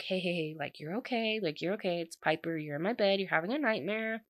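soft, gentle retold reassurance; hushed comforting voice; soothing. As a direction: imitate gently waking someone from a nightmare. Waking him from a nightmare.

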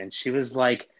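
usual speaking voice

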